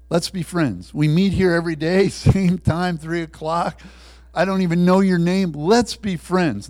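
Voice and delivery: loud, high, pressing appeal; insistent urging. Acted. Prisoner urges his jailer to be friends, loudly insistent.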